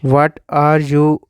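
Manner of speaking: ordinary talk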